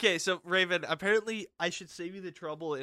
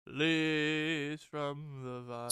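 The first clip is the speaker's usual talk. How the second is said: Uncle Iroh song; much slower than usual. Retold or imitated. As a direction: sing it gently like the old uncle. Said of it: "Uncle Iroh, bruh".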